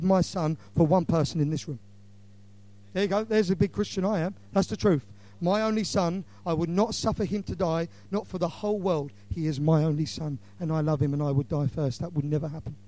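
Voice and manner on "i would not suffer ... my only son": ordinary talk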